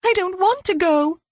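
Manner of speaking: put-on high, frightened girl's protest; child crying out. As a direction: cry out like a scared little girl. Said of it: "cried Belinda".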